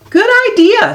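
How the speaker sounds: high, bright delighted reply; a mother thrilled by the plan; eager agreement. Acted.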